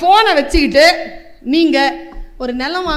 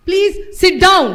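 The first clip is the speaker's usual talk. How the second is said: very slow, higher and louder stern command; stern device voice; 7 semitones higher, 5 dB louder, much slower than usual. Retold or imitated. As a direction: order them to sit, slowly. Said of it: "அவ்வளவு கடுமையா பேசுறா". (மிக மெதுவான கடுமையான கட்டளைக் குரல்)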